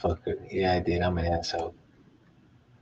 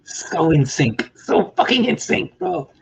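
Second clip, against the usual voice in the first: slow, loud, climbing ever higher in awe; awed; 11 semitones higher, 5 dB louder, slower than usual. Real, not acted. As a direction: savor each word, building in wonder. Awed, slow, rising pitch.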